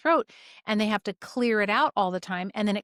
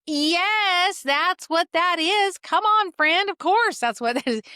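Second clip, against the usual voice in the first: high, loud, emphatic re-enactment; mock exasperation; 9 semitones higher, 5 dB louder, slower than usual. Practitioner answering patient about yellow or green mucus, playfully exasperated. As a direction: hammer the obvious, pitch soaring, then easing. Exasperated confirmation of obvious symptom.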